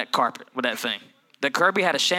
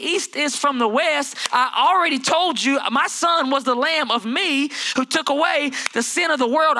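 preaching at full force; 9 semitones higher than usual. Real, not acted. Preacher driving home God's forgiveness. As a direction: preach it with fire.

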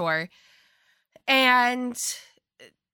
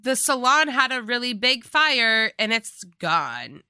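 loud, higher mock phone-call voice; hammed-up raised voice of an alarmed mom; imitated urgent mom; 4 semitones higher, 6 dB louder than usual. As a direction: blurt out bad news as the mother. Voices mom's bad-news phone call.

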